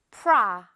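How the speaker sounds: 프라 with a wide open ah vowel; 프라 said with a wide open mouth. Shown as a demonstration. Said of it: "이렇게 크게 벌려줘야 돼요".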